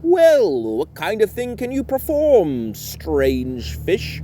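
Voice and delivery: high, loud guard voice; a high guard calling down to the fish; guard's voice. Acted. Mermaid guard quizzing the odd fish, high voice.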